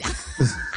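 quick unvoiced chuckle; short breathy laugh. Real, not acted.

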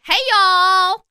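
Southern drawl greeting; Southern accent. Pretend. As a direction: say it like a Texan. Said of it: "we say".

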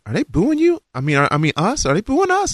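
high, startled teammate voice; hint of a panicky teammate; teammate in shock. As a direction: voice a flustered friend catching himself. Teammate voiced, correcting himself.

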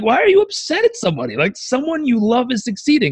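high, quick baffled questioning; voicing your own disbelief at the jealousy; incredulous. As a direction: ask it like you can't believe yourself. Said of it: "And you're like".

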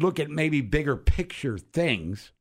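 usual speaking voice

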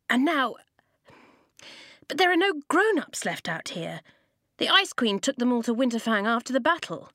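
a worried boy blurting out the bad news; upset boy. Boy protests about missing grown-ups.

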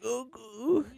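drawn-out, airy imitation of Enrique. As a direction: coo it softly in a put-on Enrique voice. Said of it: "tu as la voix d'Enrique".

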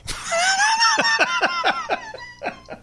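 long, high burst of laughter; real, sustained high laughing fit. Real, not acted. Laughter at his story's punchline.

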